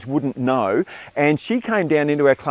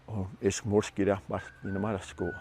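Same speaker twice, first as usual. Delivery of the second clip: low, soft mumbled made-up language; quietly mumbled fake words; mumbled gibberish; 5 semitones lower, 9 dB quieter than usual. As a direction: mumble nonsense under your breath. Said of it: "he'll mumble to our linguist".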